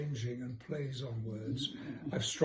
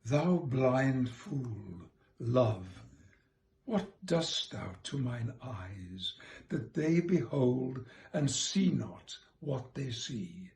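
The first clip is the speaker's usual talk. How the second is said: measured poetry reading, louder; reciting a sonnet; 6 dB louder, slower than usual. Acted. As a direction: read the sonnet with care. Reads sonnet aloud.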